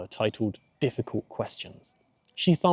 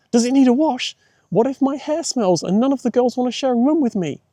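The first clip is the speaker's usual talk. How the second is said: a high, louder voice rising in worry; worried young girl, raised and higher; anxious girl; 12 semitones higher, 5 dB louder than usual. A narrator's reading. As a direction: fret out loud like a panicky child.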